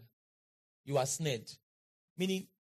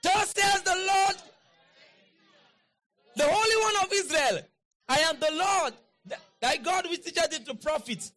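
high, loud prophetic declaration; full-force scripture proclamation; 10 semitones higher, 5 dB louder than usual. Real, not acted. Preacher declaring Isaiah to the congregation, high and forceful. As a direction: proclaim it high, like a herald of God.

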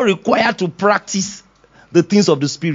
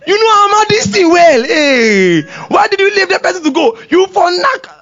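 higher, louder scolding rant; mock angry flesh; 12 semitones higher, 5 dB louder than usual. The flesh scolding him for forgiving, a loud mock rant. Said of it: "The flesh will come back".

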